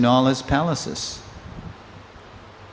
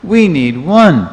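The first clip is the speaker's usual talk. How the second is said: slow, louder and insistent; pressing; 6 semitones higher, 10 dB louder, slower than usual. Retold or imitated. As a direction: push firmly, word by word. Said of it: "Again, pressing".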